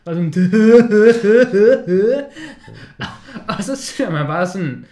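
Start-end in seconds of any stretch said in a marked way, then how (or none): 0.4-3.5 big real laugh bursting out; loud burst of laughter. Real, not acted. Laughing hard at the actor's crooked grin. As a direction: crack up loudly, can't hold it.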